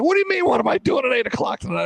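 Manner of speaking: high, fast, incredulous retort; retold indignant snap. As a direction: fire it back fast, still half-mad. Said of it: "I'm still pissed".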